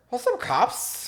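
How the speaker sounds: high, slow, slurred; drunk slurring voice. Pretend. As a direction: play a tipsy driver greeting the police. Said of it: "I'm gonna do that now".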